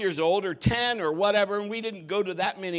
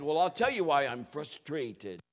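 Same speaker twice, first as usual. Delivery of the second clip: put-on lower, softly echoed French accent; mimicked French accent; 6 semitones lower, 4 dB quieter than usual. Pastor mimicking the lunch guest back at her, French accent. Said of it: "he'll start talking like that".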